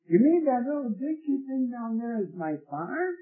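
high, disbelieving question; incredulous question. Retold or imitated. Farmer shown his farm from the airplane, incredulous.

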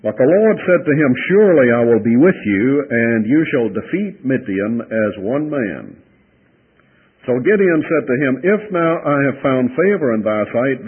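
solemn Bible reading; scripture reading. Acted. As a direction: read the passage to the congregation. Preacher reads scripture aloud.

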